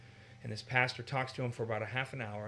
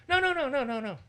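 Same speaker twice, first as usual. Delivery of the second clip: high, loud eager no-no-no; high eager welcome, no no no; 13 semitones higher, 8 dB louder than usual. Shown as a demonstration. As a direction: rush to insist they sit. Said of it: "all the different ways you would respond".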